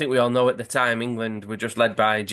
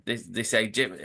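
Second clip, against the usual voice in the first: ordinary talk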